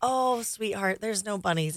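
soft, slow let-down; gentle mother. Retold or imitated. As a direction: break it gently to your child. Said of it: "And I'm like".

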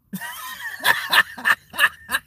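real laugh breaking up into a high squeal; high burst of laughter. Real, not acted. Laughing at a fan hyperventilating, high squealing laugh.

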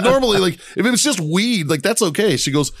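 normal talking voice

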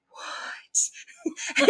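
airy, drawn-out stunned "what"; stunned disbelief. Retold or imitated. Diner overhearing an absurd insurance remark, stunned. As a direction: barely get it out in mock shock. Retold stunned reaction mid-bite.

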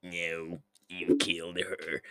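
put-on sinister old emperor voice; Palpatine. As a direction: play the evil emperor breaking bad news. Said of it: "Palpatine is like".

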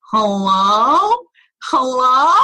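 high grating hello, mimicked; annoying phone voice. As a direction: imitate the woman's off-putting voice on the phone. Friend hears disturbing voice on phone.

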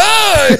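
high-pitched mock yelp; imitated yelp of pain. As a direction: yelp as if drilled in the back. Imitates player hit by ball.

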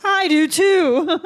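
loud, high, drawn-out whine; mock jealous wail. Bartender wanting to drink all day too, loud whine. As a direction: wail it out long with comic envy.

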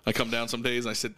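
usual speaking voice

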